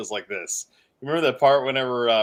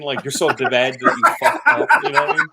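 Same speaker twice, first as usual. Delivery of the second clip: laughing; 6 dB louder than usual. Real, not acted. Laughing at his own joke.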